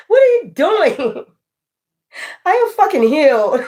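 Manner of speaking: high, laughing mock disbelief that ends in a shout; laughing taunt. Real, not acted. Taunting the coven through laughter, high and shouting. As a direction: crow with laughter that it no longer works.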